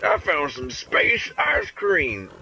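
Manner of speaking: mock alien. Playing an alien finding space food.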